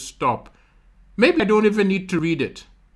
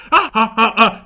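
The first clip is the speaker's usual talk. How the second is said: sharp repeated "ah" cries; loud, higher bursts of alarm noise; exclaimed sound effects; 4 semitones higher, 6 dB louder than usual. Retold or imitated. Passengers phoning family after the landing, exclaimed sound effects. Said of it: "sound effects is just part".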